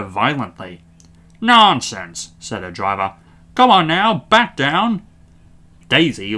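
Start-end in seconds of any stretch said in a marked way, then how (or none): 1.4-2.3 raised, loud rebuke; brusque dismissal. A narrator's reading. Driver scolding Daisy. 3.5-5.0 loud, raised urging; firm order. A narrator's reading. Driver ordering Daisy.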